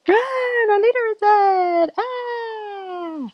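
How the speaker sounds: very high, wailing cry of alarm; mock panic. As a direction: squeal it like a fleeing minion. Orcs flee after leader's death.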